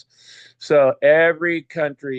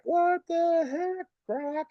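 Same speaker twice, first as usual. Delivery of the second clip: high, slow, disbelieving squeak; what the heck, stretched out in disbelief; incredulous; 4 dB quieter, much slower than usual. Real, not acted. To Grok about taxing an imaginary coin, high disbelief.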